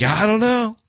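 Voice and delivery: high-pitched imitation of a tipsy shrug; clueless drunk. Imitating evasive drunk friend's shrug.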